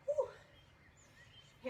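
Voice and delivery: high breathy cheer of relief; excited whoop. Real, not acted. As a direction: let out a quick celebratory woo. Celebrating finishing the curls.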